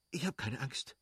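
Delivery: soft, quick brave words; hurried reassurance. Acted. Kitty tells Levin she is not afraid, soft and quick. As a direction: try to sound unafraid. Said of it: "stieß sie rasch hervor".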